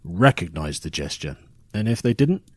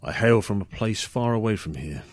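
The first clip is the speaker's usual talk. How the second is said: normal talking voice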